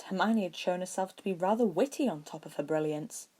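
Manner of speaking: ordinary delivery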